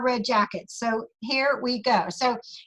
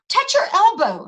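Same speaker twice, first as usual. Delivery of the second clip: doctor's instruction; 7 semitones higher, 4 dB louder than usual. Retold or imitated. Doctor tests the patient.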